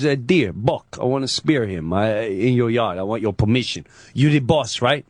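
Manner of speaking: low Godfather-style creep; imitating the pizza guy. Pizza guy asking the yard's owner for permission, low creepy impression. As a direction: explain it in a low mobster voice. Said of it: "he talks like this".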